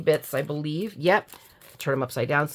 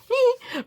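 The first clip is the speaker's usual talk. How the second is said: tiny high-pitched giggle; quick squeaky titter after a joke; playful giggle. Real, not acted. Giggles after joking about singing.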